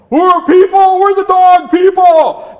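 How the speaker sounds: very high, loud dog shout; high cartoon dog, slow and insistent; protesting dog. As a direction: yell an indignant protest as a dog. Dog protests being shut out.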